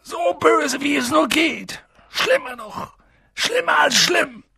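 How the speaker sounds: very high, intense exclamations; horrified emphasis. A narrator's reading. Giant describing utter evil, high and agitated. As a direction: press each phrase with dread.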